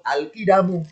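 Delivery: normal talking voice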